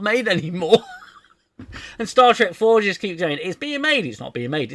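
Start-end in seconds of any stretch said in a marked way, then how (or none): 3.4-4.1 very high, loud mock excitement; mock announcement. Mimics back-and-forth film announcements. 4.1-4.7 deflating answer in the everyday voice; mock retraction. Mimics the contradicting announcement.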